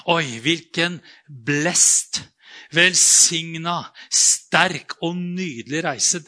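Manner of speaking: slow, drawn-out starry-eyed wonder; dreamy mock awe. Imagining the blessed journey, slow mock wonder. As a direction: savour each word like a naive believer. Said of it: "Så tenker man".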